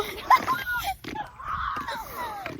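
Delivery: high, long squealing laugh; shrieking laugh. Real, not acted. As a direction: burst into a wild cackle. Big laugh about ants.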